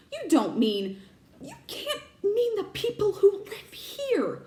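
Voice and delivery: aghast at where Harry will live; horrified cry. Acted. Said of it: "cried Professor McGonagall".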